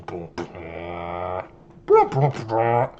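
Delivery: slow low boom boom effect; imitated sound effect. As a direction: voice the sound board drum hits. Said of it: "Can we get a boom".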